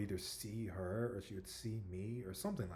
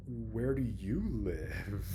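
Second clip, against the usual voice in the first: very slow, drawn-out imitation of himself; nervous echo; 5 dB louder, much slower than usual. Telling how he nervously echoed his crush's question, awkwardly drawn out. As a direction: stretch every word out in an awkward parroted question. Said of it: "I was just so nervous".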